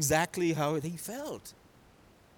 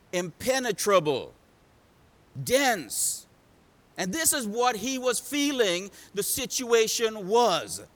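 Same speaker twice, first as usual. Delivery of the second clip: ordinary talk, nothing marked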